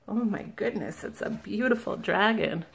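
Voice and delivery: usual speaking voice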